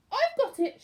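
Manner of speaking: high, loud sudden idea; excited cry. A narrator's reading. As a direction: burst out with the idea. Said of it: "she cried".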